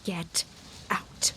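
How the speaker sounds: hoarse broken whisper. Acted. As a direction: breathe it out, spent. Diana after quarrel, drained.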